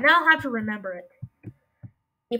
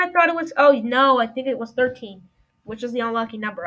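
ordinary talk, nothing marked

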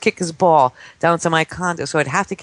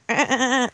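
imitated high dolphin squeaks; dolphin chatter; 7 semitones higher than usual. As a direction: chatter in short bursts like a dolphin. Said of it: "come right up to me as dolphins".

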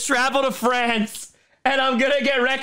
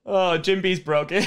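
normal talking voice

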